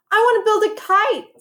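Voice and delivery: put-on high, keen little-boy voice; eager child. A narrator's reading. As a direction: announce it with excitement. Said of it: "said Percy".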